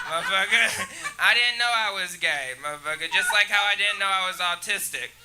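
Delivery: ordinary talk, nothing marked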